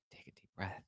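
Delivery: barely audible whisper; very soft, all breath; whispered self-calming. Real, not acted. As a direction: whisper it to steady yourself. Calming himself after outburst.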